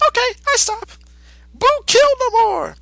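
put-on very high childlike monster voice; Boo voice. Boo answering Mister Satan simply, in a very high childlike voice. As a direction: answer like an innocent little creature.

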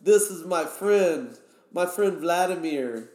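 high, emphatic Russian buddy voice; imitated Russian guy. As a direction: imitate a Russian proudly introducing his friend. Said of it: "one of the Russian guys. He's like".

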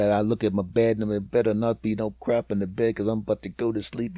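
usual speaking voice

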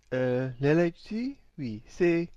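quiet, badly faked French accent. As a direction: play a halting French caller, slipping into French. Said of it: "you can't do a French accent".

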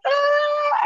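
a sudden high wail, voice leaping up as she relives breaking down; retold wail. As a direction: imitate the burst of crying after the gym, sharply pitched. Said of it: "like, wailing and just tears".

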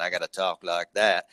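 light imitation Texas twang; put-on Texas accent. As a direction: talk like a make-believe Texan from Western movies. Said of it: "like I was from Texas".

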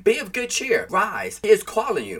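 high-pitched, lifted scripture quote; encouraging call. A narrator's reading. Crowd to the blind man, high encouraging call. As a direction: call out the good news to him.

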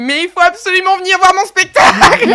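high, loud, eager plea; excited high-pitched insistence. Retold or imitated. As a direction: beg him to come see the show. Eagerly pitching her show to director.

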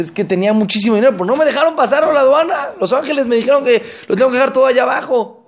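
high, loud, laughing complaint; soul excusing himself to the angels, laughing; sheepish laughing excuse. Acted. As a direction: laugh it off like a caught man.

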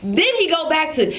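normal talking voice

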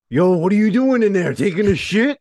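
high, loud heckling shout; mock hollering. Carmen calling to Antonio in the bathroom, loud mock holler. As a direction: holler it at someone through a bathroom door. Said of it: "and Carmen's like".

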